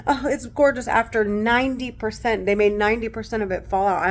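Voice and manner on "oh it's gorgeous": 1 mock cheerful. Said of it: "they want me to say".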